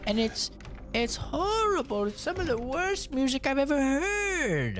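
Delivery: put-on high prim old-lady voice, shocked; mimicking mom. Son mimics mom's horror at sister's music, high and prim. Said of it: "And she's like".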